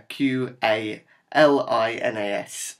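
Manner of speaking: Q A L I N A S spelled slowly, one letter at a time. Shown as a demonstration. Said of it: "that can't understand my, my Norfolk accent".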